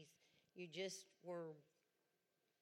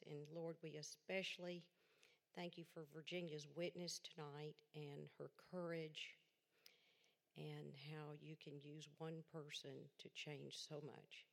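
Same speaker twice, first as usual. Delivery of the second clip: church prayer; 4 semitones lower than usual. Acted. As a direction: pray gently and humbly for the evening. Opening prayer before the testimony.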